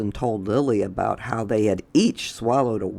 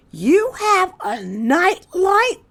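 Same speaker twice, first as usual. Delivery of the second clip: very high, slow, louder character voice; Chester's voice; 5 dB louder, slower than usual. Acted. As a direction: ask it way up high and slowly. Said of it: "said Chester".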